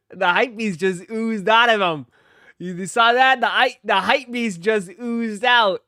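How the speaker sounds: loud, high, animated reaction; worked-up, high-pitched exclaiming; excited outburst. Real, not acted. Loud excited reaction to hype clip.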